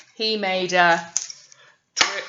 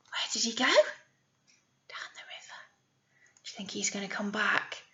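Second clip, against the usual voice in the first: fast hushed whisper, much softer; 9 dB quieter than usual. Real, not acted. Teacher whispering questions to the children. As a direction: whisper like sharing a secret.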